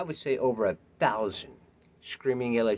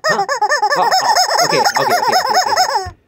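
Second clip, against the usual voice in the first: very high, loud flustered okays; mock startle; 14 dB louder than usual. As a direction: yelp like you got caught. Startled reaction.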